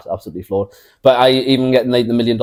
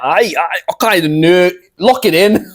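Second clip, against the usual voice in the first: much higher, laughing Scottish voice; co-host answering in hammed-up Scottish, giggling; mock Scottish accent; 8 semitones higher, 4 dB louder than usual. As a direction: play along in a silly Scottish accent, laughing. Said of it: "Sorry for anyone who is Scottish listening".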